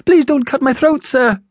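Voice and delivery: put-on very high, frightened little boy; terrified high-pitched plea; pleading in terror. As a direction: beg for your life in a loud, high child's voice. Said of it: "I pleaded in terror".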